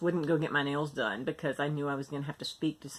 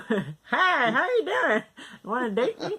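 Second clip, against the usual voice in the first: cheesy flirty voice; 9 semitones higher, 5 dB louder than usual. Pretend. Mock flirting at her new smile.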